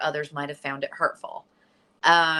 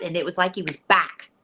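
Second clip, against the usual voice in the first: ordinary talk, nothing marked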